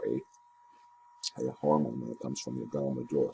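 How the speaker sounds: talking around a mouthful of ice; words muffled by ice cubes. Real, not acted. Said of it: "a mouthful of ice cubes all of a sudden".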